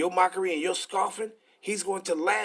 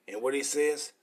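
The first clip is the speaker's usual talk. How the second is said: usual speaking voice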